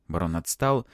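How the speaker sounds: usual speaking voice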